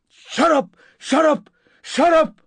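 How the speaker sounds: high, loud, furious screams; screaming. A narrator's reading. Narrator chasing her up the hall, screaming. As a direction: scream each one separately. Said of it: "I was screaming now".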